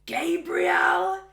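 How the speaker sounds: high, loud, slow command; stern shout. Retold or imitated. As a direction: summon him like a furious parent. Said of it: "Katie going".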